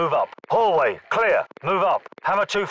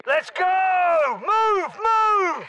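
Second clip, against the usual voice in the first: battle shout; much slower than usual. Acted. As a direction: scream them into motion. Combat escape shouting.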